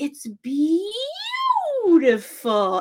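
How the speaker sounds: a touch of loud mocking on beautiful. Teacher making fun of Carrie's word, lightly mocking. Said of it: "starts making fun of her".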